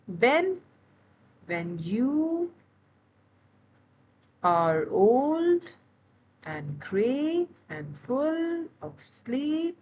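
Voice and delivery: put-on slow sung-out iambs, "you" and "old" leaned on; iambic stress. Shown as a demonstration. Teacher reads the Yeats line to students, stressing each beat. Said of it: "an example of the syllables".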